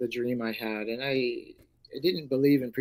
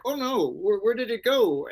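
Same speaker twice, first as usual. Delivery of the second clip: alarmed; 8 semitones higher, 5 dB louder than usual. Retold or imitated. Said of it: "I'm like".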